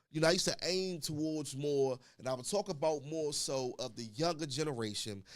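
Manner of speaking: usual speaking voice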